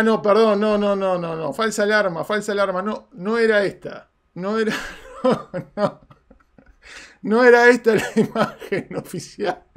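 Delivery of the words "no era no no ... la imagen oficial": real laugh through the correction. Real, not acted. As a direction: laugh at your own slip. Catching own mistake, laughing. (riéndose de su propio error)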